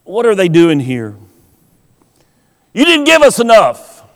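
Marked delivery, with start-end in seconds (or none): 2.7-4.0 complaining. Retold or imitated. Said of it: "they complain".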